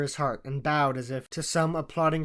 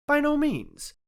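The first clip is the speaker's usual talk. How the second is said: eager; 13 semitones higher, slower than usual. A narrator's reading. Watson answers eagerly.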